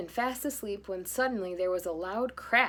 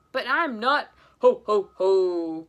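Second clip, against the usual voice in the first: loud booming jolly ho-ho; Santa; 12 dB louder, slower than usual. Acted. Santa calling from the fireplace, booming. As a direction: let the laugh roll big.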